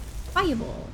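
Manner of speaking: Fireball yelled high in frustration; high, annoyed Fireball; exasperated retry. Real, not acted. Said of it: "God damn it".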